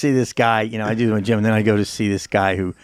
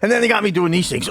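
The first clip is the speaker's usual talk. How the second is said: ordinary delivery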